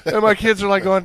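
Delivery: ordinary delivery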